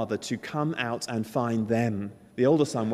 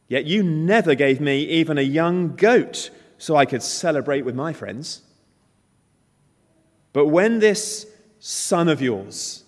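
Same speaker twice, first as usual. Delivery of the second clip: slow, bitter complaint building louder; older brother complains to his father, bitter and loud; resentful anger; 2 semitones higher, 6 dB louder than usual. Retold or imitated. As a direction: pour out years of grievance. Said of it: "We're to hear the anger".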